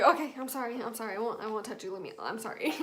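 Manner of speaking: husband's hushed, backing-off apology; meek apology. Retold or imitated. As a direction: imitate a chastened husband, softly. Husband backs off apologizing, retold.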